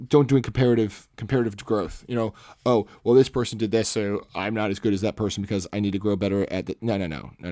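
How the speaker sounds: ordinary delivery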